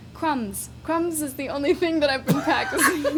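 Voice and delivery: amused, amateur Cockney; practised Cockney, breaking into laughter; Cockney accent. Shown as a demonstration. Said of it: "Cockney accent".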